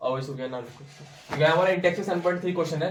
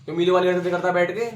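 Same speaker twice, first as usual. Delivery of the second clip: losing patience, voice pushed up and loud; real exasperated scolding; 3 semitones higher, 6 dB louder than usual. Real, not acted. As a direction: scold a study partner with raised voice. Scolding the other over the answer.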